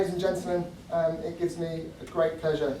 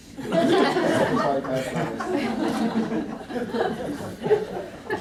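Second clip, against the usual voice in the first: big laugh; 8 semitones higher, 5 dB louder than usual. Real, not acted. Laughing at own joke.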